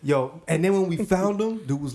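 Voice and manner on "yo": ordinary talk, nothing marked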